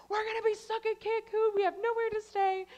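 high-pitched mock panic; freaking out. Stuck in Cancun with a canceled flight, freaking out. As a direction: act out a frantic, stranded freak-out. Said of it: "I'm freaking out".

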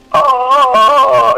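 high, loud startled oh. Retold or imitated. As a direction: sound caught off guard. Scammer caught off guard.

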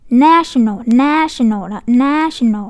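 "National" with a wide-open, stretched NA; wide-open vowel. Shown as a demonstration. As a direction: open the mouth fully on NA of "national". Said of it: "đọc mở miệng hết cỡ".

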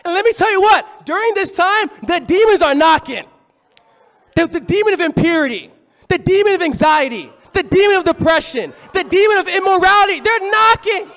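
high, loud shouted sermon climax; full-force preaching, shouting they're knocking; fiery preaching. Real, not acted. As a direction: hammer the warning home to the church.

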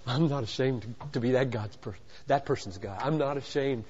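very low, hushed voice; low and soft, almost to himself; quiet divine declaration. Acted. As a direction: say it softly and deep, as God. Voicing God's quiet declaration.

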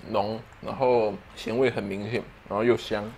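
talking between bites; chewing while talking. Real, not acted. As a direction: mouth partly full of food. Seen 0: takes a bite at -5, jaw and lips still chewing through 2.9.

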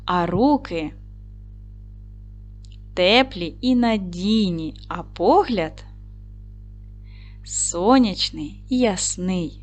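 a slow poem reading with long pauses between words. Acted. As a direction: read the little poem gently to the children, slowly. Reads a short poem. (повільне, ніжне читання віршика)

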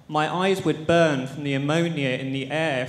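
usual speaking voice

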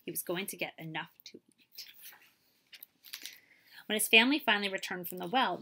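plain reading voice

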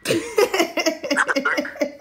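high, helpless cackling; real high laughing fit; uncontrolled laugh. Real, not acted. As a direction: laugh until you tear up. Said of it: "I need to stop fucking laughing".

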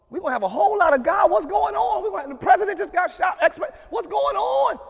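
high, fast and loud; excited mock alarm. Pastor acting startled before the congregation, hammed-up shock. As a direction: burst out like breaking news.

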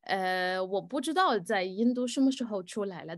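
ordinary delivery

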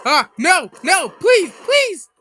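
real plea as he's losing; desperate pleading. Real, not acted. Gamer begging the game to spare him, frantic.

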